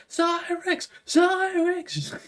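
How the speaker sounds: high-pitched panicked cry of a name; frantic calling out. Acted. Game master as a shocked courtier running to Zyrex, frantic calling.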